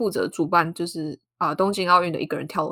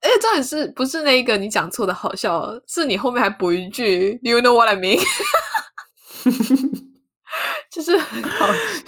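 laughing; 6 semitones higher, 5 dB louder than usual. Real, not acted. Cracking up at friend.

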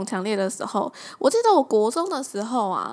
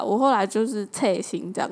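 ordinary talk, nothing marked

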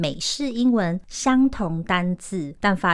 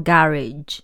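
British "garage", stress on the first syllable; British pronunciation; 3 semitones lower than usual. Shown as a demonstration. Teacher contrasting what British people say, British pronunciation. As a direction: say "garage" as GA-ridge, British style. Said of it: "英国人则是说garage".

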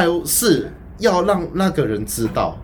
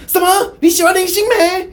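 high, loud disbelieving cry; much higher, louder mock shock; shocked classmate; 13 semitones higher, 4 dB louder than usual. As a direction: gasp it out like you can't believe it.

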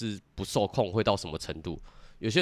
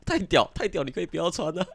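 squad leader; 7 semitones higher than usual. Retold or imitated. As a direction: answer the recruit like a clueless sergeant. (高声学班长回话)

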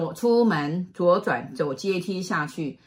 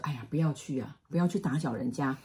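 much softer, lower hushed caution; gentle warning; 4 semitones lower, 7 dB quieter than usual. Retold or imitated. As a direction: hush the child kindly. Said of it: "他说".

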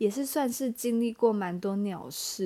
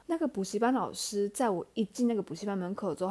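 usual speaking voice

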